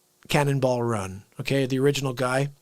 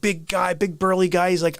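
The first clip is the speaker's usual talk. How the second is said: ordinary talk, nothing marked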